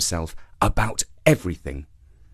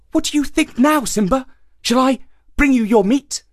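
much higher, sly hare; high, teasing hare; 13 semitones higher, 3 dB louder than usual. A narrator's reading. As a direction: tempt the lion.

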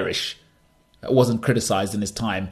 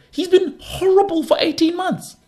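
mock outrage. Mocking the critics' verdict.

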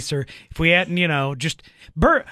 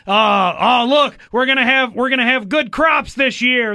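hammed-up medieval quack, pitched up and louder; mock quack healer; 6 semitones higher, 5 dB louder than usual. Speaker mocks a medieval quack healer. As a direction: play a boastful fake doctor selling bones.

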